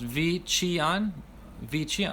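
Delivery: "V Chian" said slowly as "vee chee-ahn"; pronounced name. Shown as a demonstration. Host sounds out a viewer's mom's name, "V Chian" slowly. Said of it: "is pronounced".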